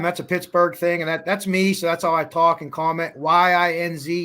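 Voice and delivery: ordinary delivery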